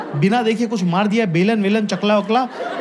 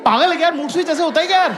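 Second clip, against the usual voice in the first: higher, fast incredulous; mock exasperated retort; 8 semitones higher, faster than usual. Comic retells snapping at girlfriend in disbelief. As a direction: blurt it out high in disbelief. His own annoyed retort.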